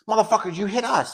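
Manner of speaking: high-pitched mock scream, volume held back; retold scream. Swearing at the police, restrained retold scream. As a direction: imitate screaming without the loudness. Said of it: "I was, I was screaming".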